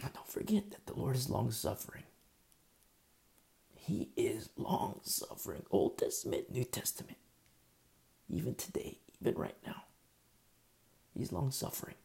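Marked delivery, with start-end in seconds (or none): 3.7-9.8 breathless hushed whisper of awe; reverent whisper. Acted. Preacher to congregation, a reverent whisper. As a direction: whisper it like a sacred secret.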